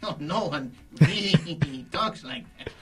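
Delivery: high, giggly impression with creepy laughs; Peter Lorre. Shown as a demonstration. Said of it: "yeah, Peter Lorre".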